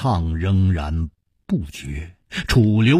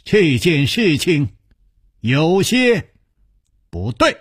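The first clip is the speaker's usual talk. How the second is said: higher, halting dawning doubt; startled realization; 7 semitones higher, slower than usual. A narrator's reading. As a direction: break it up as the suspicion takes shape. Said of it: "失声说".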